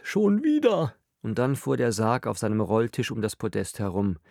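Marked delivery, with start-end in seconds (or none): 0.0-0.9 high, loud exasperated outburst; exclaiming. A narrator's reading. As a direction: cry it out, pitched way up. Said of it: "rief Sita Pfeiffer aus".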